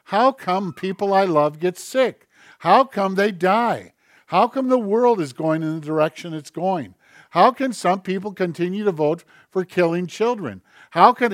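fervent pulpit outcry, pitched high; full-force preaching. Acted. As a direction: build the questions into a cry.

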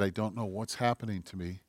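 low, hushed, heavy-hearted quote; subdued, low and very soft; retold crying pastor. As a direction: quietly retell a pastor's tearful confession. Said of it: "he started to cry".

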